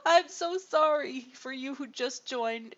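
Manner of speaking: high, loud, drawn-out mock wail of apology; mock anguish. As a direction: play the heartbroken host, hammed up. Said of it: "It's like".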